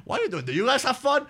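high-pitched mock crowd hype; exaggerated high, excited cheering; stalling with hype. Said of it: "empecé a como que tratando".

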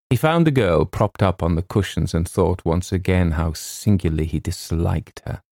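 ordinary delivery, nothing marked

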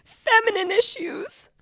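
high whispered sob-story excuse; mock tearful excuse. Schoolgirl excusing lateness to the teacher, whispered pitifully. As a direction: whimper it like a teary schoolgirl. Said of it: "I'd start crying and go".

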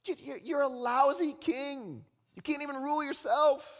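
high, incredulous telling-off; mock rebuke. Preacher mocks a would-be king, high rebuke.

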